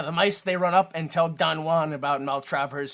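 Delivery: ordinary talk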